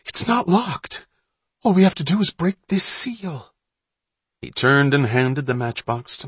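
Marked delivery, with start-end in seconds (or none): 0.1-3.5 high, breathless eagerness; panting excitement. A narrator's reading. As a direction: rush of boyish excitement, pitched high. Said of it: "he panted".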